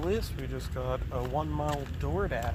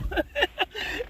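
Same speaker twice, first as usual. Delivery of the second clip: laugh. Real, not acted. Laughing at the story.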